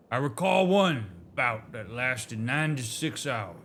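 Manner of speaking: light Southern drawl for a storytelling elder; a hint of a Southern drawl, proud and nostalgic. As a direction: read it like a Southern elder recalling old glories. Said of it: "the southern molasses coming out of their mouths".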